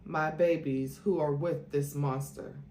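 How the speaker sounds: plain reading voice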